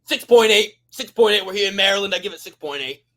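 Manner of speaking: loud pizza reviewer voice; loud, brash pizza review; Dave Portnoy impression. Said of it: "Dave Portnoy".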